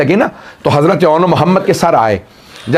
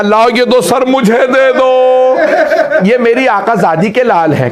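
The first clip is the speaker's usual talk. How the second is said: urgent plea; 5 semitones higher than usual. Acted. Fizza demands the heads.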